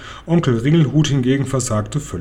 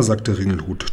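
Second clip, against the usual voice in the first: ordinary delivery, nothing marked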